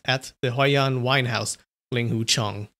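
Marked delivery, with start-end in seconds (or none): none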